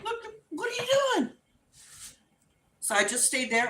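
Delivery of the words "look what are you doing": very high, rushed protest; frantic, half-laughing plea; panicked disbelief. Retold or imitated. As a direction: squeal it in mock alarm. Panicked question to mom, retold.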